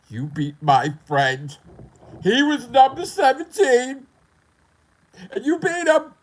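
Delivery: high, loud, slow outburst; hammed-up yelling protest, climbing in pitch; mock aggrieved yell. Player protesting his friend's defeat, mock yelling.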